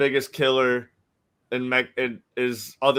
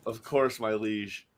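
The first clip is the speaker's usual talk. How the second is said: mock courtly reply; 3 semitones lower, 6 dB quieter, slower than usual. Bowing to mock royal.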